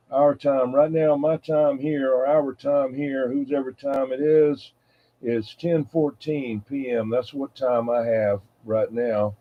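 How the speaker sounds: usual speaking voice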